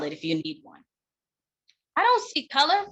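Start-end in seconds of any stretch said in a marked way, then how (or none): 2.0-2.9 high, bright mocking quote of a clueless speaker. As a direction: parody someone saying it innocently. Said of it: "That is a classic favorite trauma trigger".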